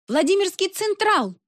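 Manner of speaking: a mock yell thrown from the crowd, pitched high; sudden shout. A narrator's reading. Lyubochka shouts a song request at the concert, high mock yell. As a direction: blurt the song title out of nowhere. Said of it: "крикнула неожиданно Любочка".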